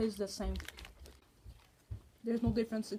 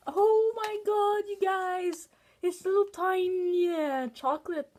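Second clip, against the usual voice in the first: high, slow, delighted oh my god; excited gush; 8 semitones higher, 5 dB louder, slower than usual. Real, not acted. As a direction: gush over something adorable. Gasping at tiny cute chocolates.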